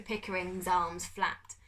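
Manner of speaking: usual speaking voice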